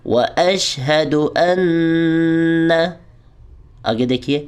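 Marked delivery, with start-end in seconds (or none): 0.1-2.7 واشہد ان with a long nasal hum on the ن; nasal ghunna. Shown as a demonstration. Teacher modelling ghunna. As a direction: hold a nasal ن in ان. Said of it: "دوبارہ سنیے".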